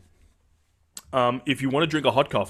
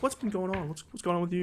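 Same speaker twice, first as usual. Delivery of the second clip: wife's questions lightly voiced, higher and softer; a soft, higher mock wife asking what's up; imitating his wife; 7 semitones higher, 7 dB quieter than usual. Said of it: "she was like".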